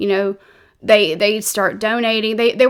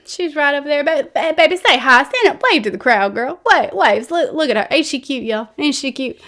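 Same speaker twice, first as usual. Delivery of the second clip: higher, louder hammed-up preacher parading his girl; mock preacher showing off; 4 semitones higher, 3 dB louder than usual. Preacher parading young mistress before congregation, hammed-up and loud. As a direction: ham it up like a preacher bragging to his flock. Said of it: "as stupid as that sounded with me doing that".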